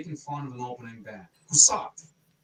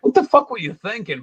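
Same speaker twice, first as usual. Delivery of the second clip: imagined blunt question; 7 semitones higher, 8 dB louder than usual. Retold or imitated.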